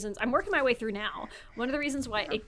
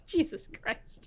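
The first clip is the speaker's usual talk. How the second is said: ordinary talk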